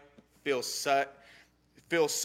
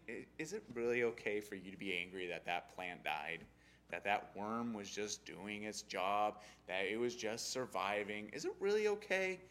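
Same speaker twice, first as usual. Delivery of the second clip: soft, patient, much quieter question; gentle God; 5 semitones lower, 10 dB quieter than usual. Retold or imitated. God gently asking angry Jonah, soft and patient. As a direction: coax him like a calm parent. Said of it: "that's how I pictured God is right here".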